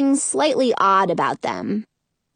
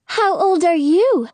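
tiny faraway voice; 7 semitones higher than usual. Acted. Tiny fairy asks.

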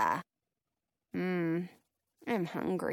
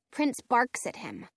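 ordinary delivery, nothing marked

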